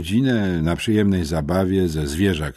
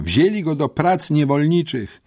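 high, gleeful announcement to the crowd; exclaiming; 8 semitones higher, 3 dB louder than usual. A narrator's reading. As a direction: crow it to the other girls, pitched up. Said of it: "wykrzyknęła". (wysoki okrzyk uczennicy)

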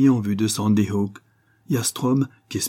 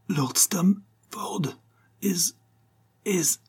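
high, halting, strained dying whisper; hoarse, barely audible; 9 semitones higher, much slower than usual. A narrator's reading. Wounded man trying to name someone, hoarse and barely audible. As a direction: force the words out faintly, piece by piece. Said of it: "d'une voix rauque à peine perceptible".

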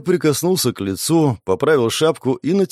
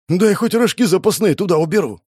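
higher-pitched, flustered plea; fussing; 7 semitones higher than usual. A narrator's reading. Young man hurrying to pack up, fussing. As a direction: fuss over stowing the spare magazines. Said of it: "начал суетиться парень".

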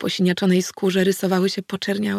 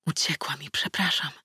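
whispered sorry; guilty whisper; whispering apology; 3 semitones lower, 8 dB quieter than usual. A narrator's reading. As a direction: whisper it ashamed. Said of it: "wyszeptał".

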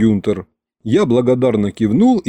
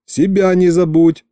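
raised, higher-pitched call; called after someone; 6 semitones higher than usual. A narrator's reading. Narrator calls after the departing butler, raised call. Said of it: "крикнул я ему вслед".

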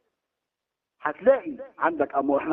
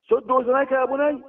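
urgent warning; 7 semitones higher than usual. Retold or imitated. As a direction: repeat the warning to your son with force. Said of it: "قال له".

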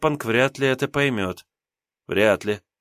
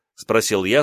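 plain reading voice

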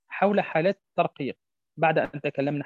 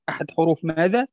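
normal talking voice

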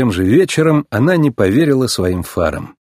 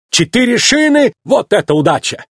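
high, thrilled Italian shopkeeper; agitated delight, high-pitched; excited exclamation; 11 semitones higher than usual. A narrator's reading. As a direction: burst out at your amazing luck. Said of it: "взволнованно воскликнул Луиджи".

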